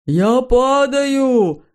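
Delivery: drawn-out high scared yelp; terrified cry. A narrator's reading. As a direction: cry out falling in terror. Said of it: "в ужасе вскрикнул он".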